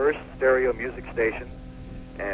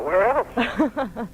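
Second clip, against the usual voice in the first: mock incredulous; 12 semitones higher, 3 dB louder than usual. Obvious answer joke.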